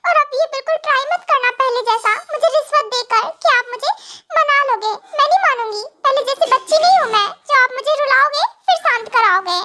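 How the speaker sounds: a tearful wife refusing to be won over; sulking young wife. Acted. Young wife Cookie sulking, crying. (ऊँची आवाज़ में रूठी हुई रोती पत्नी)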